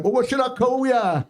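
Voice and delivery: high, slow, breezy cheek; airy cheek. Retold or imitated. Cocky boyfriend answering the grumpy dad, airy and high. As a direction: toss it off like a cheeky lad. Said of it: "Then he got all airy".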